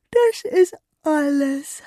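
slow, loud yawned reply; yawning. Acted. Sleepy fairy answers Jonna through a big yawn. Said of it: "gähnte die Fee".

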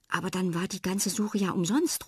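ordinary delivery

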